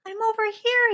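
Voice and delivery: high, loud yell. Acted. Jubal yelling back to his rescuers through blinding rain, loud. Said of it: "he yelled".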